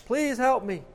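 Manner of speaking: high, loud cry into the dark; shouting. A narrator's reading. The falling man shouting again into the darkness, loud and high. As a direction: call out desperately into nothing. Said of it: "the man shouts into the darkness".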